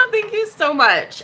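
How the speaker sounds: high loud laugh into drawn-out thanks; delighted laughing. Real, not acted. Host thanking a viewer, laughing high. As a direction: laugh high, then give slow gushing thanks.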